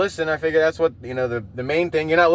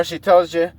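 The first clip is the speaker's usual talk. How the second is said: usual speaking voice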